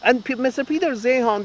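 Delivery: ordinary delivery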